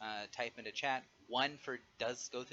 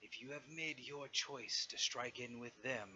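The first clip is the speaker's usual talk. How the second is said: dragon voice; slower than usual. Acted. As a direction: speak slowly like a weary old dragon. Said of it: "Safaraktar's voice calls out again and says".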